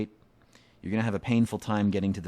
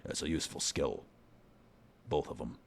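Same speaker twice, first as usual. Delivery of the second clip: put-on deeper, gruff British blacksmith accent; gruff Scottish smith; 3 semitones lower than usual. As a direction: growl it out like a crude dwarf smith. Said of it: "vary it up for him".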